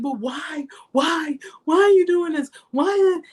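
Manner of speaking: begging to know why, climbing in pitch; retold desperate pleading.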